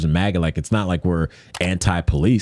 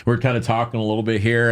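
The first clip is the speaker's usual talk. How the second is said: ordinary delivery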